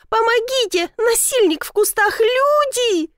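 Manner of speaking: high-pitched cry for help; alarmed yell. A narrator's reading. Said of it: "завопила она". (истошный зов на помощь)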